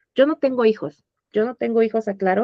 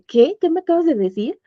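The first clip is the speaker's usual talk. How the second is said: quick, high mock indignation; mock annoyed; 8 semitones higher than usual. Kids imitating the teacher pretending to be annoyed. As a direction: pretend to be cross. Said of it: "para verme enojada".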